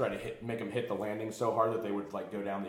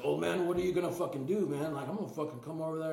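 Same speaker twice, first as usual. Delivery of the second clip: higher, fast put-on punk voice; tough-guy threat; 8 semitones higher than usual. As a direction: rush the threat like a hothead. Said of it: "He's like".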